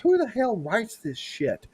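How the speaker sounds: high, incredulous outrage; exasperated. Real, not acted. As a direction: scoff at it in disbelief. Mocking the book blurb.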